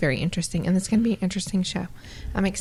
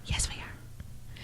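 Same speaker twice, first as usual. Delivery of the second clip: gasping whisper, very slow; 15 dB quieter, much slower than usual. Pretend. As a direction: whisper it like a secret. Gasp then secretive reply.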